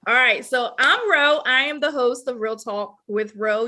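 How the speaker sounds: ordinary delivery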